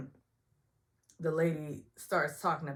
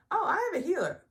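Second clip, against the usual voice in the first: high, loud mock cast voice; Sonya's reply; 14 semitones higher, 7 dB louder, faster than usual. Imitating Sonya answering the medium, high and loud. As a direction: toss it off in a lightly voiced Sonya. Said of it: "And Sonya says".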